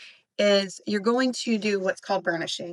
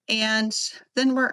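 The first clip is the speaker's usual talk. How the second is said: ordinary talk, nothing marked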